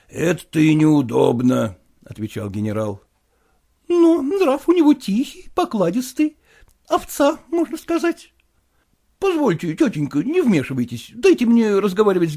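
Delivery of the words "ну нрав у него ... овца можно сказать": высокий голос восторженной тётушки; тётка хвалит племянника; голос тётки. Acted. Aunt voiced. (тётка вставляет слово и хвалит племянника, голос выше)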